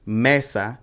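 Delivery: Mesa as ME-sa; stress on first syllable. Shown as a demonstration. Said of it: "you put the stress on the first one".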